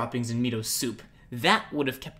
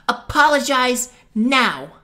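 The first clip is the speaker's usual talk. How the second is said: much louder, slow and fierce; fierce order; 7 semitones higher, 10 dB louder, slower than usual. Acted. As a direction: make him obey at once. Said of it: "Saria's fierce tone".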